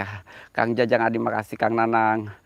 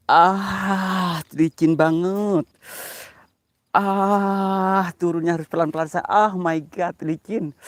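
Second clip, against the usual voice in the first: higher, tense and slow; nervous; 7 semitones higher, slower than usual. Real, not acted. Descending a slippery slope, nervous. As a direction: edge down carefully, nervous.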